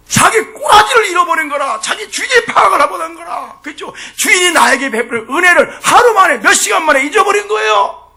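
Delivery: high, shouted reproach from the pulpit; full-force preaching. Real, not acted. Preacher rebuking those who forgot the master's grace, full force.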